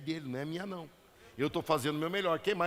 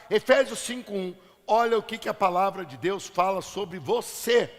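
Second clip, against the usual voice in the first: ordinary talk, nothing marked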